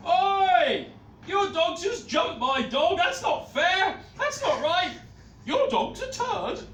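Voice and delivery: furious high-pitched yelling dog owner; angry shout. Acted. Dog owner shouting across the show ring, furious. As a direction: scream it like an outraged owner at ringside.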